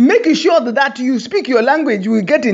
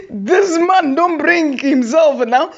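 laughing retort; 6 semitones higher, slower than usual. Retold or imitated. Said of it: "I was like".

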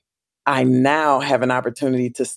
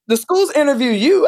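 incredulous; 12 semitones higher, 5 dB louder than usual. Retold or imitated. Echoing assumption that schools interview.

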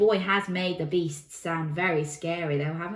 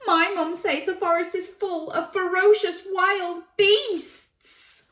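put-on high, loud child voice; alarmed boy; 11 semitones higher, 6 dB louder than usual. A narrator's reading. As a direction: warn like a frightened little boy. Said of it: "declared the boy".

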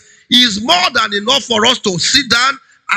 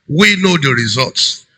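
ordinary delivery

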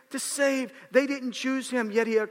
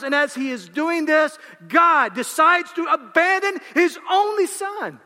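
higher, louder preacher's cry; full-force pulpit climax; fiery preaching; 5 semitones higher, 3 dB louder than usual. Acted. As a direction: build the crucifixion to a shout.

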